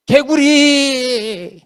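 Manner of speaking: long drawn-out, high cry; mock pleading call. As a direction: stretch the word into an exaggerated, wailing plea. Imitating Moses calling for frogs.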